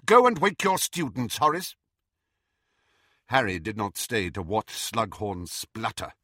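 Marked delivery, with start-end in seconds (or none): 0.1-1.8 raised, firm order; commanding McGonagall. A narrator's reading. Professor sends Slughorn to wake his students, commanding. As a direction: dismiss him with an instruction.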